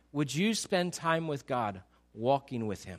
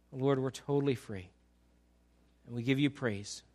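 pastor praying for the church at the end of the sermon; closing prayer; 4 semitones lower than usual. Acted.

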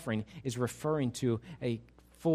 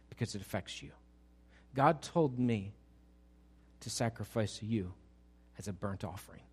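hushed confession; 5 semitones lower, 7 dB quieter than usual. Pretend. Father breaking news to son.

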